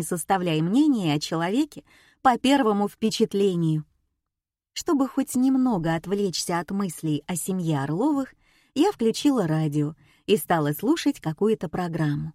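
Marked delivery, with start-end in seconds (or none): none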